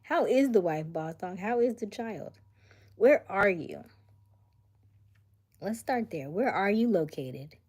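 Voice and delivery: loud, high rapid questions; playful grilling. Real, not acted. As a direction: interrogate him playfully.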